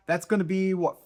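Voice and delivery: ordinary delivery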